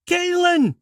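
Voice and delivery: high, loud shriek. Acted. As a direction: shriek his name across the beach. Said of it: "she shrieked".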